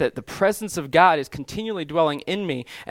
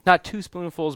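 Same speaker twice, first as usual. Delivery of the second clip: ordinary delivery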